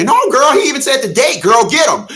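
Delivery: high, loud mock girlfriend voice; mocking friend. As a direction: egg her on in a loud high girl voice. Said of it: "And her friend".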